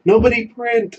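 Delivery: sharp, high call across the office; shouted warning. Pretend. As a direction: yell it out to stop everyone. Mock call to coworkers not printing.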